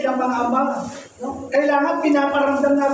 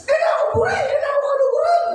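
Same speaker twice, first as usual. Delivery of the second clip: voicing the child's shout; 9 semitones higher, much faster than usual.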